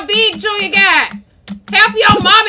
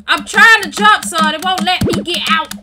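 ordinary talk, nothing marked

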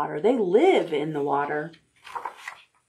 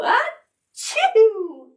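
fake sneeze; 3 dB louder than usual.

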